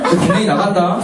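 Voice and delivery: normal talking voice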